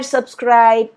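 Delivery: plain reading voice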